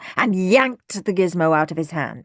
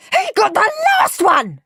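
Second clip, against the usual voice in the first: high, loud screech of complaint; 6 dB louder than usual. A narrator's reading. Henry telling Mum about his brother, screeching. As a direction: screech the accusation. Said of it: "screeched Henry".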